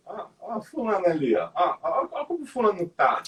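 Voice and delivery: leve deboche em voz mais aguda; chacota encenada. Acted. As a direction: imite quem aponta alguém para zombar. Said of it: "você quer fazer chacota com alguém". (tom de chacota, de leve)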